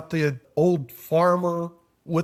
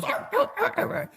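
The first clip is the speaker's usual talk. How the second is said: high, strained dog groan; imitated dog whine; 4 dB quieter than usual. Storyteller imitating farmer's dog on a nail, whining. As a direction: voice a dog's repeated sore yelps. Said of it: "every so often he goes".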